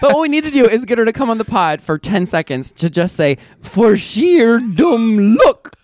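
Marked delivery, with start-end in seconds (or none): 3.6-5.7 slow, prim Scottish teacher voice; drawn-out stern old dame; Maggie Smith impression. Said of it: "spot on exactly how she says it".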